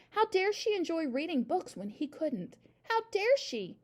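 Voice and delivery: high-pitched affronted complaint; indignant. A narrator's reading. Jealous father resenting his daughter's love of books, indignant.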